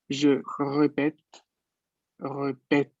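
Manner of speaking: répète slow, first E closed, second E wide open. Shown as a demonstration. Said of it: "La segunda E es completamente abierta". (répète lento, la segunda E bien abierta)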